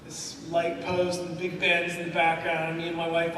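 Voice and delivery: usual speaking voice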